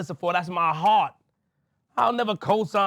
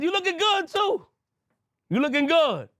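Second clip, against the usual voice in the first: playful compliment; 9 semitones higher than usual. Real, not acted. Complimenting friend, high voice.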